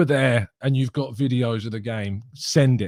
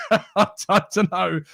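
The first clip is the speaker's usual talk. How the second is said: laughing shrug; 7 semitones higher, much slower than usual. Real, not acted. Laughing at striker debate.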